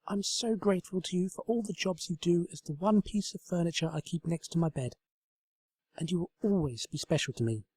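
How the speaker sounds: affectionate pony voice; tender Twilight. A narrator's reading. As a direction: speak fondly to the table. Twilight thanks table.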